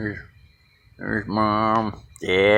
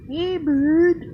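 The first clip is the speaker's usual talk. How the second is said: squeaky falsetto greeting to a bird; high bird call. Real, not acted. Man greeting visiting birds in a squeaky falsetto. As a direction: call up high and thin like a birdie.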